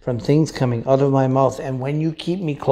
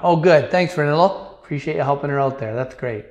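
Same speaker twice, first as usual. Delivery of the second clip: normal talking voice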